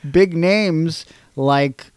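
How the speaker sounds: slow and deliberate. Acted. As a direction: stretch each name out. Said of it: "slowed name list".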